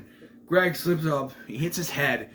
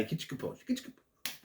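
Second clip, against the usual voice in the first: whispered self-calming; 2 semitones higher, 10 dB quieter, faster than usual. Real, not acted. [name] telling himself to calm down, whispering. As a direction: breathe it out to calm down. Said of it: "calming himself down".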